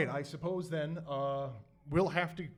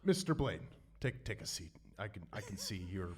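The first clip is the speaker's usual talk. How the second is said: much softer, low confiding voice; quiet in character; 10 semitones lower, 9 dB quieter than usual. Acted. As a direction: lean in and speak softly. Commissioner addresses hero in character.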